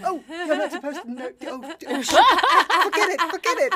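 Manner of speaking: high, loud, laughing panic; flustered laughing. Retold or imitated. Flustered driver takes back swear.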